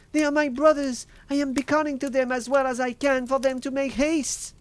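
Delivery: very high, loud urgent call; excited cry. A narrator's reading. Sister beckoning the brothers to hurry, excited high cry. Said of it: "she cried presently".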